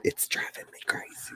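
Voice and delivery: whispered confession. Real, not acted. Complaining to chat about gray hair, whispered. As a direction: breathe it out as a secret.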